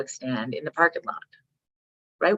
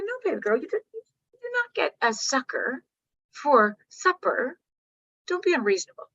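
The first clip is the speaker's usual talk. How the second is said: patient mom; 9 semitones higher than usual. Retold or imitated. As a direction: gently hold the line. Mom refusing calmly.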